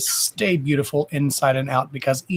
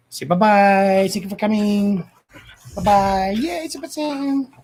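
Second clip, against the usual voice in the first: higher, slower sing-song pet voice; 7 semitones higher than usual. Pretend. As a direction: speak for the dog in a high baby voice. Voicing the dog.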